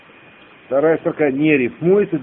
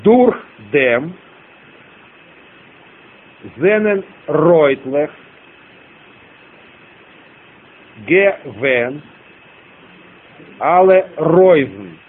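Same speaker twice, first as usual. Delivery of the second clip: drawn-out pairs of words, long pauses; slow dictation; much slower than usual. Shown as a demonstration. Teacher repeating the line for students, slow dictation. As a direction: read it back slowly in small chunks. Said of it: "я медленно продиктую".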